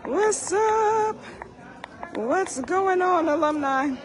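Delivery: ordinary delivery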